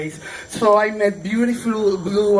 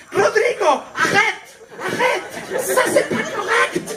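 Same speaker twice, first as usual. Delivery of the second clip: much higher, urgent French yell; mock yell; 12 semitones higher than usual. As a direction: cry out to stop him at once. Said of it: "the director just yelled".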